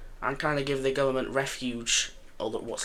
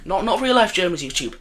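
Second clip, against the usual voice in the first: hasty disclaimer; 7 semitones higher, 9 dB louder than usual. Real, not acted. Quickly disclaims a joke.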